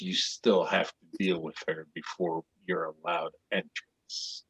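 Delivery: low, soft, ominous warning; hushed, low taunt; ominous slave seller. Acted. Seller turns, laughs, warns the buyer.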